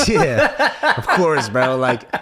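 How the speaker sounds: words breaking up into laughter; laughing. Real, not acted. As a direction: agree through high, loose laughter. Cracking up while agreeing.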